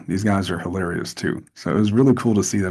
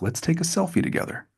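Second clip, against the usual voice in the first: friendly Cena; 9 semitones higher, 6 dB quieter than usual. Retold or imitated. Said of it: "and he's like".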